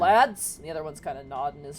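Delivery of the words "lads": loud clipped nod of greeting; gruff guard greeting. Acted. Guard greeting the other guards, loud. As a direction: bark a greeting to fellow guards.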